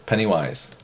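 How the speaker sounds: ordinary talk, nothing marked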